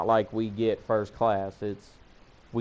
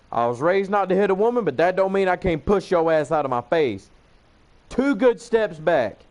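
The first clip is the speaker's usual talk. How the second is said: higher-pitched heated re-enactment; angry warning; 6 semitones higher, 3 dB louder than usual. Retold or imitated. Him ordering the woman out of his face, angry warning.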